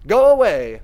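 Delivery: loud tearful-sounding brush-off; Clarissa shouting go away. Acted. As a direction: shout it through the door to send her off. Clarissa voiced refusing through door.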